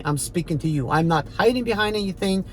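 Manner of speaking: ordinary talk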